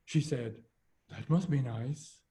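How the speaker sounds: ordinary talk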